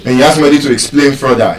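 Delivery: normal talking voice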